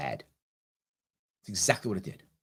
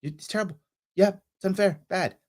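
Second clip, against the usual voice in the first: voiced friend agreeing; 7 semitones higher than usual. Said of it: "If she does".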